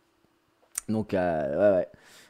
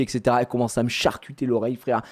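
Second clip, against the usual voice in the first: ordinary talk, nothing marked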